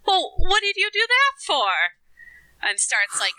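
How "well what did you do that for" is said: high-pitched, fussing nurse voice; scolding nurse. Acted. Nurse examining the bleeding arm, high-pitched scolding. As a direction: chide the patient in a much higher voice. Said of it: "She says".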